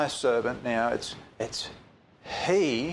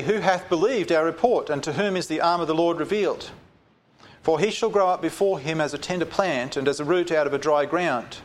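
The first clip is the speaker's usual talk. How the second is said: raised, ringing Bible reading; solemn raised reading voice; scripture reading; 4 semitones higher than usual. Acted. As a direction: proclaim the verse to the congregation. Reads scripture aloud.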